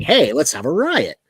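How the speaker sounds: loud villain call. Retold or imitated. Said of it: "calling the guy in the van saying".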